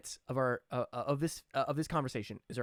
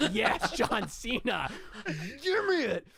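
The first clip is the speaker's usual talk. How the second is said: high, slow, pleading; eager fan; 8 semitones higher, 3 dB louder, slower than usual. Retold or imitated. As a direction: beg like a delighted fan. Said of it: "You're like".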